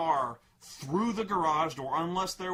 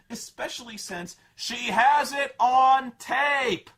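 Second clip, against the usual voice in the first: loud, slow exasperation; exasperated rant; 7 semitones higher, 10 dB louder, slower than usual. Real, not acted. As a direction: hammer it out in disbelief. Ranting frustration.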